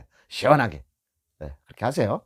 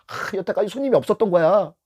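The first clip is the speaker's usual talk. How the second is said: normal talking voice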